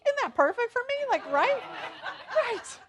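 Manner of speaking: squealing high and loud with delight; thrilled. Real, not acted. Pastor gushing to the congregation about a chocolate tour, thrilled. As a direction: gush over the perfect chocolate tour.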